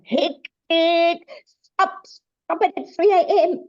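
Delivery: hammed-up cross outburst, laughing through it; mock temper flare. Speaker mimicking yelling at her cats at 3 AM, laughing.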